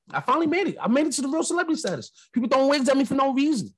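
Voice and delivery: high, giddy and fast; mock celebrity thrill. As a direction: gush like a new star. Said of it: "I was like".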